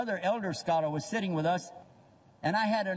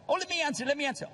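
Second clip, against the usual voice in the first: eager know-it-all; 9 semitones higher, much faster than usual. Retold or imitated. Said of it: "just want to jump out and give answers".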